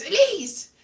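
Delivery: mock someone calling out to get attention; calling someone's name. Someone addressing her directly by name, sharp call-out. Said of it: "if somebody goes".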